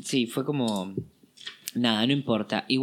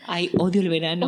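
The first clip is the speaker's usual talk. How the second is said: higher, louder whine; whiny complaint; 5 semitones higher, 4 dB louder than usual. Pretend. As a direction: moan about summer theatrically.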